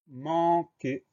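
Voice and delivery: "manquer" stretched into two separate syllables; slow dictation. Shown as a demonstration. Dictates new word syllable by syllable.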